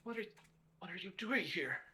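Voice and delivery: falter, then ask in alarm; frightened. A narrator's reading.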